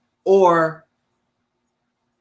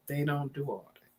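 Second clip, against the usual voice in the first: much softer and lower, trailing away; fading murmur; 7 semitones lower, 16 dB quieter than usual. Real, not acted. Repeating to herself about dad's side, softly fading. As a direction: let the repeat die under her breath.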